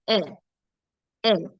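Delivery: "L" as an open, soft "eh"; very soft sound. Shown as a demonstration. Teacher showing E with accent grave, "L" as a soft open "eh". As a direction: say "L" softly open, twice with a pause. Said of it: "it makes a very soft sound".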